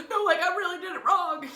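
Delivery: high, loud frantic little-brother imitation; panicking. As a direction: insist like a freaked-out kid. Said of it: "he's panicking".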